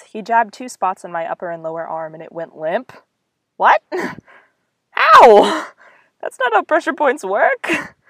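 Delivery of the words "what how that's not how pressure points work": high, loud incredulous laugh-talk; girl laughing at Steve's fake pressure points, high and loud; laughing disbelief. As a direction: crack up at the silly move. Laughing disbelief at paralysis move.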